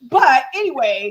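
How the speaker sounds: normal talking voice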